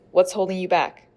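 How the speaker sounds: ordinary talk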